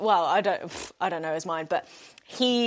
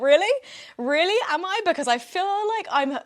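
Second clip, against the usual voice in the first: incredulous; 9 semitones higher than usual. Retold or imitated. Date says she's handling it well.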